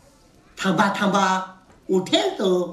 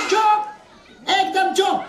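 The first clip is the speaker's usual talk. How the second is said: silencing command; 6 semitones higher than usual. Acted. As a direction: shut a giggling class up. Warden silencing laughing pupils. (हसणाऱ्या मुलांना गप्प करण्याचा हुकूम)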